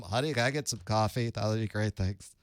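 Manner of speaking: casual at-home voice, not radio; normal home voice. Shown as a demonstration. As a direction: ask the wife lightly for coffee. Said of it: "This is my radio voice".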